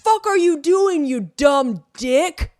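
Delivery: loud, high-pitched mock yell; imitated angry yelling. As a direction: yell it so it carries through the house. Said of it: "I'll hear that from the other level of the house".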